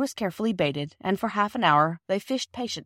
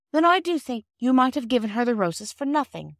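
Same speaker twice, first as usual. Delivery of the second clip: mildly indignant, higher voice; a touch of righteous reproach; 6 semitones higher, 4 dB louder than usual. A narrator's reading. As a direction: scold lightly as an offended girl. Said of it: "said Bobby indignantly".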